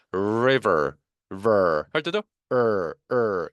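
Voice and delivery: "river" broken down to "ver" and a held "으" like "er"; English R pronunciation. Shown as a demonstration. Teacher isolates the final R, "ver" and "으" r-coloured. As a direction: end "river" on a retracted, drawn-out r. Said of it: "이렇게 되는 것이죠".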